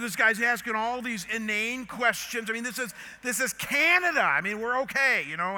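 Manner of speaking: loud, high complaint; indignant rant. Retold or imitated. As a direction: retell an exasperated rant about petty questioning. Retold rant about border guard.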